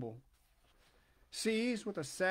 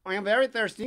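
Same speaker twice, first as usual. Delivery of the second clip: pigeon's voice; 2 semitones higher, 8 dB louder, faster than usual. A narrator's reading. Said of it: "the pigeon said".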